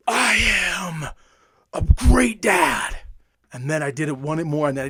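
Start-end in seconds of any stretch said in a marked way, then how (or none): 0.1-3.0 high, loud, slow shout of I am a great dad; shouted affirmation. Shown as a demonstration. Dad screaming his affirmation into the mirror, loud and slow. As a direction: belt it out to the mirror. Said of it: "my hand started to do this".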